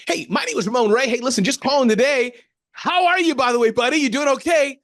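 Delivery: overly cheerful salesman calling a stranger; peppy caller voice. Shown as a demonstration. Ramon demos his peppy phone call voice, high and bubbly. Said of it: "Here's how I talk to him".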